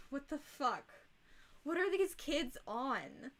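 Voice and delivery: usual speaking voice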